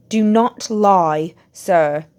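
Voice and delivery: loud, slow angry command; shouted. A narrator's reading. Mr Crouch accusing the boy of lying, slow loud shout. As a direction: spit out each word in rage. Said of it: "shouted Mister Crouch".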